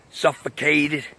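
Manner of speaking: ordinary talk, nothing marked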